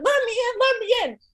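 high imitated begging; high pleading. Teen begging her best friend to open up, high pleading. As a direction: plead it like a scared kid.

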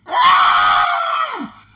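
very high, loud shriek; hammed-up shriek. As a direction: let out a mock scream. Shrieking reenactment.

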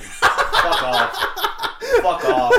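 loud, high, breaking into laughter; laughing protest. Real, not acted. Podcaster teased about his dark silhouette, laughing. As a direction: curse him off while cracking up.

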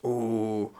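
ordinary delivery

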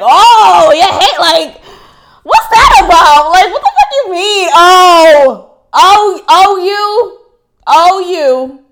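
loud, high, drawn-out exclamations; mock shocked reaction. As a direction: act out an over-the-top "oh!" reaction. Acting out reactions to being Haitian.

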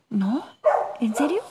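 can't believe what he just heard; stunned disbelief. Acted. Axel shocked that they never reached the surface.

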